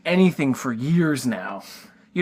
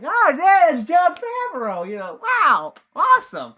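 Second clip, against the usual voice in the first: much higher, gushing fan delight; imitating a starstruck fan, pitched high; mock excitement; 13 semitones higher than usual. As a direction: gush as if spotting a celebrity. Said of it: "one of those lovable".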